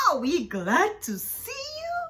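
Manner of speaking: high, loud relieved cheer; overjoyed kids; excited. A narrator's reading.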